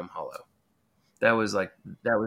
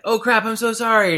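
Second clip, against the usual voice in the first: high, loud flustered apology; re-enacted embarrassed backpedaling; panicked apology; 10 semitones higher, 8 dB louder than usual. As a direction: blurt a mortified sorry, pitch jumping up.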